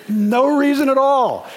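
joyful laughing yes to baptism. Retold or imitated. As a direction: burst it out with a laugh. Punchline after a joke.

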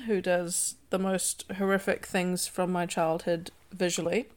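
ordinary delivery

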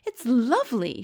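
a touch of wonder and excitement; crying out. A narrator's reading. Sophie after tasting Frobscottle, mildly crying out in delight. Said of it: "she cried".

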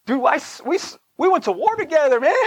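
high, pleading disbelief; mock wounded buddy; exasperated plea. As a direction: protest like a hurt old comrade. Hurt thought at pastor's snub.

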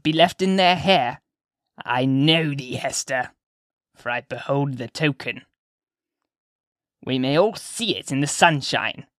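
put-on slow, emphatic old woman's voice; insistent old witch-lady. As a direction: press the accusation as a knowing old crone. Mistress Hibbins scolds, then confides.

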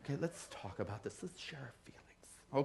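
very soft, low, near-whispered soothing; mock gentle counselor. What Jesus didn't say to Peter, mock gentle. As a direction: imitate an overly tender heart-to-heart, slowing at the end. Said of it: "he didn't go".

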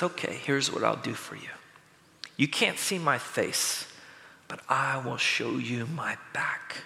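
a hushed, lightly acted God. God answers Moses in a low, hushed voice. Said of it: "And God says".

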